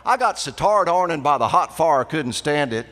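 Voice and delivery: loud, high Outer Banks brogue from the sound side; Outer Banks sound side accent. Shown as a demonstration. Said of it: "that beautiful Elizabethan dialect".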